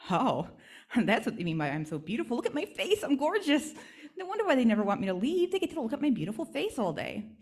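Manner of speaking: high, giggly and self-admiring; delighted with her own reflection; vain giggling doll. Acted.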